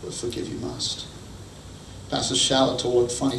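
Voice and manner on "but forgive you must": much softer, whispered must; hushed repeat. Shown as a demonstration. Preacher repeating the command in a hush. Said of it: "repeated for emphasis".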